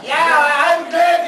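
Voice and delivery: slow, high, loud shout; shouted claim. Pretend. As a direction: bellow it out.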